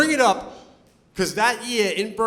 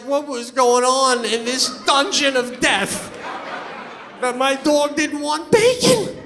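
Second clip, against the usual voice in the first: slow, higher suspicious worry; inner alarm; 7 semitones higher, slower than usual. Retold or imitated. Owner suspicious about what went on at the kennel, slow and worried. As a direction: wonder darkly what happened. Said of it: "I'm like".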